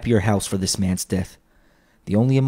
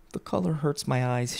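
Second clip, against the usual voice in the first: softly voiced mother, pitch raised; understated sorrow, higher and softer; subdued mother; 5 semitones higher, 6 dB quieter than usual. A narrator's reading. Mother excuses her eyes at her sewing, softly subdued.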